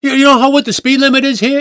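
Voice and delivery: high-pitched scolding busybody impression; mock chastising. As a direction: imitate her pulling up a speeding driver. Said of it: "she begins to chastise him".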